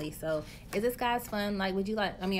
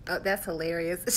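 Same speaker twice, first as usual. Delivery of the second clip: ordinary delivery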